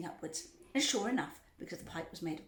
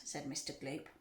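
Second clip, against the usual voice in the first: plain reading voice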